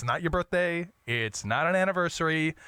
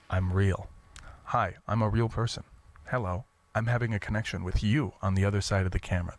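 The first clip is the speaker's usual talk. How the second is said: lower, intimate, relaxed talk; sincere and close; 6 semitones lower than usual. Shown as a demonstration. Host demonstrating an authentic sincere camera voice. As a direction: speak to one real person through the lens. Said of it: "you get close and you just be sincere".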